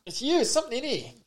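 high, soft mock accusation; high-pitched teasing. Mock sniffing out a smell.

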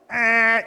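loud buzzer-like eh of irritation. Retold or imitated. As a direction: blurt a harsh buzzer noise. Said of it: "It's the buzzer".

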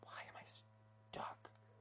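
a slow private whisper, lost for words; whisper to self. Real, not acted. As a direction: whisper the question to yourself. Stuck, muttering to himself.

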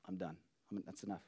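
low, quiet resignation; giving up. Acted. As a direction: let it drop, done with it. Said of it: "we throw up our hands".

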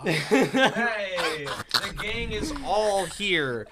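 slow, loud hyped announcer. Acted. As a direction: introduce the guest like a game show. Announcer intro of guests.

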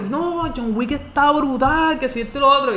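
mock raving, voice lifted high; hyped-up fan imitation. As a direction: mimic a friend overselling a movie. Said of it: "me la venden como que".

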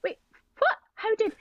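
high, bewildered squeak; retold stunned wait what; shocked. Retold shock at filthy look.

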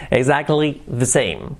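ordinary talk

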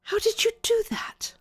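very high, breathy wonder; breathless, high-pitched marvelling; awed amazement. Acted. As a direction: ask it in hushed astonishment. Amazed at the changed clothes.

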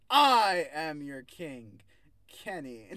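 very loud, drawn-out declaration; hammed-up roar, stretched out slowly; mock royal proclamation. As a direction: bellow it like a self-crowned king. Mock proclamation echoing the queen's roar.

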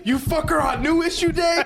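very high-pitched mock outrage; high, needling mock rebuke; mock scolding. Mockingly replays a friend's scolding.

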